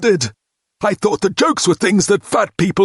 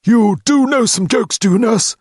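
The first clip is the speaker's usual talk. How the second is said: challenging; 13 semitones higher, 4 dB louder than usual. A narrator's reading. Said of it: "he challenged her".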